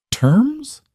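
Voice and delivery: sharp high disbelieving rise; incredulous. Acted. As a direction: scoff at the word in disbelief. Mia scoffs in disbelief.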